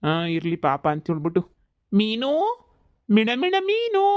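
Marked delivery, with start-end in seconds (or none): 1.9-4.0 high eerie sing-song call from beyond; haunting whisper-call gone shrill; ghost calling. Acted. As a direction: lure her name out like a ghost. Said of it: "ದೆವ್ವ ಮಾತಾಡ್ತಾ ಇದ್ರು".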